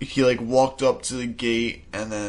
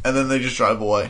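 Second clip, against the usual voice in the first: normal talking voice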